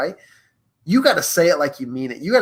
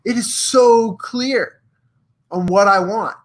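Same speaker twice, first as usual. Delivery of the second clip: drawn-out, higher and emphatic; excited realization; 6 semitones higher, slower than usual. Acted. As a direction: stretch SO CLEAR with rising excitement. Imagined excited realization, oh so clear.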